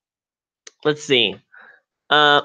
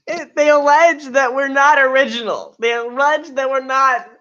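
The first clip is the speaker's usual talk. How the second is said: mock hurt; 6 semitones higher than usual. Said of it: "I can't take you seriously right now".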